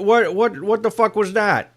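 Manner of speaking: hammed-up angry MAGA churchgoer; mock outraged congregant. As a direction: play an outraged churchgoer demanding answers. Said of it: "come up to him and all like".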